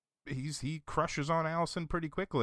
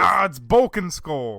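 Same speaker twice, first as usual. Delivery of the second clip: loud, excited eureka; excited discovery; 5 semitones higher, 10 dB louder than usual. Real, not acted. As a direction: found it, say it loud. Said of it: "I gotta look it up".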